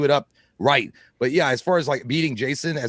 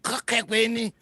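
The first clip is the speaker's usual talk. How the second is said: mumbled garble; 7 semitones higher, slower than usual. Retold or imitated. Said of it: "like she couldn't speak".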